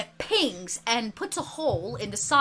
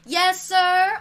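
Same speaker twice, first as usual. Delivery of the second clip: high, sing-out schoolgirl reply; mock chorus of obedient pupils; girls in chorus; 7 semitones higher, 4 dB louder than usual. A narrator's reading. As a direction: answer like a class in unison. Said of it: "you hear all the girls go".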